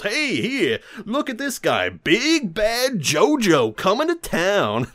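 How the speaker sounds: high, slow mocking biker. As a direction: stretch it out like a jeering biker. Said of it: "one of the motorcycle gang guys goes".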